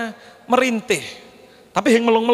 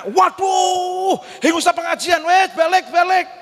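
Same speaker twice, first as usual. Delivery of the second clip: mock pained shout; 9 semitones higher than usual. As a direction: howl like a man hurt on the way. Congregant hurt, shouting to go home.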